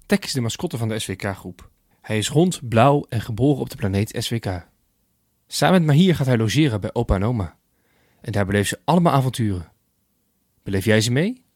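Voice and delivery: put-on bright, upbeat intro for young listeners; lively podcast intro. Host invites children to the story, upbeat and lively. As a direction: welcome the children in, cheerfully.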